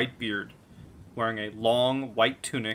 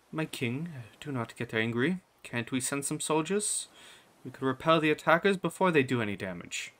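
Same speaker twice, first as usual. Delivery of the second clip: pleading queen; 3 semitones higher than usual. Acted. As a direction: gently talk the angry king down. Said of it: "or the queen, says".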